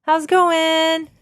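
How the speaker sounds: high, slow and eager hello; drawn-out bright greeting; cheery greeting. Retold or imitated. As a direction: call out sweetly to mom coming in. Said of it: "I was like".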